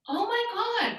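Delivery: very high, awed exclamation; high-pitched mock astonishment; retold amazement. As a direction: re-enact being floored, voice way up high. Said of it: "I was so flabbergasted".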